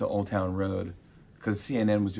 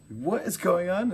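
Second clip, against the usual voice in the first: higher, slower excited question; excited disbelief; 9 semitones higher, slower than usual. Retold or imitated. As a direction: sound thrilled and stunned. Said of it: "so excited and freaking out".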